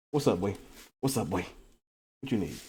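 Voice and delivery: soft, fond greeting to a puppy; talking to the dog. Real, not acted. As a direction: coo at the dog.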